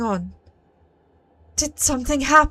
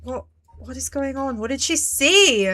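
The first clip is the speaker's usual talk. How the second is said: normal talking voice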